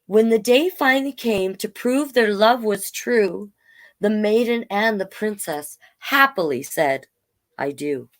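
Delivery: storybook read-aloud in rhyme; story narration. A narrator's reading. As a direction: tell it gently like a bedtime tale.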